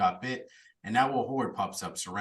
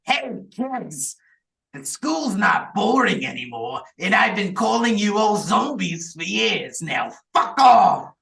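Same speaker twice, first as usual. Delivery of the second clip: high, loud imitation of a creepy nerd; creepy nerdy voice; 8 semitones higher, 8 dB louder than usual. Villain taunting trapped kids, imitated creepy nerdy voice. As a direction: build from creepy nerd greeting to shouted kiss-off. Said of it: "you get his, like, creepy, like, nerdy voice".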